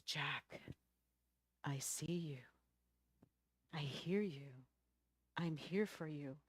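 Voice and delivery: low, soft, spaced-out phrases; lightly voiced reassuring God. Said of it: "he hears a voice".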